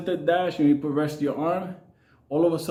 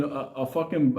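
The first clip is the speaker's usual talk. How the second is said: ordinary talk, nothing marked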